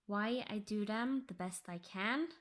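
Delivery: ordinary delivery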